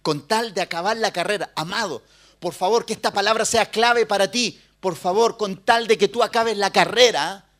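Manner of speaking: loud, high, pleading sermon delivery; preaching at full force. Acted. Pastor urges the congregation to finish their course, loud preaching.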